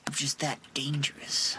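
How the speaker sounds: whispered, playfully sinister brag; mock menacing whisper. Gamer bragging to chat in a mock villain whisper. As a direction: hush it like a proud villain. Mock boast while playing.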